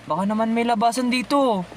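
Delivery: normal talking voice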